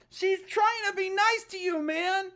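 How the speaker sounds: high, pressing complaint; irritated protest. Real, not acted. Player irritated, telling the character off. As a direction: insist she's only being nice. Repeating defense of Sayori.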